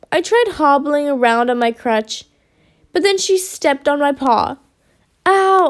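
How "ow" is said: high cry of pain; quick yelp; pained yell. A narrator's reading. Assistant steps on paw, pained yell. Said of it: "I yelled".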